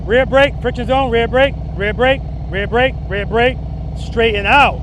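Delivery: loud called-out riding cues; shouting over engine. Real, not acted. Instructor calling cues on moving bike.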